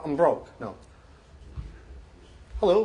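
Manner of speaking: ordinary delivery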